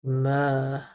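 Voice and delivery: "Mo" said alone as a single clear syllable; just the syllable "Mo"; isolated syllable. Shown as a demonstration. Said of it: "Mo is the sound".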